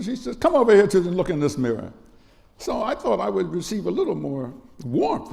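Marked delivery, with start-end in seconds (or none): 0.4-1.9 imitated stern command, higher and louder; harsh mother. Mother summoning her crying son, imitated harsh response. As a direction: order the child over as a harsh mother. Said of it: "kind of harsh response".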